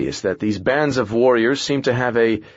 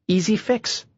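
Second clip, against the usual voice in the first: much higher, breezy brush-off; breezy dismissive; 11 semitones higher than usual. Acted. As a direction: toss it off lightly, pitched up. Dismissive character line.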